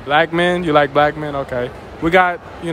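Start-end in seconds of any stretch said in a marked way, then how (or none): none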